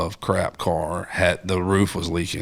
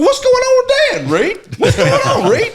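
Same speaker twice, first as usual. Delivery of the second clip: very high, louder laughing dad imitation; mock puzzled dad; 5 dB louder than usual. Dad asks brother what's wrong, very high and laughing. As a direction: ham up the baffled father.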